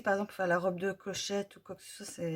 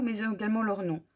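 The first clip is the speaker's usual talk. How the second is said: normal talking voice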